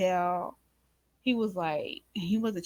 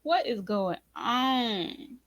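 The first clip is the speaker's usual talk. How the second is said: slow, high, crying-edged disbelief; stunned and near tears, drawn out; shocked disbelief; 4 semitones higher, slower than usual. Retold or imitated. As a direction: ask it like you can't believe your ears. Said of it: "I was just like".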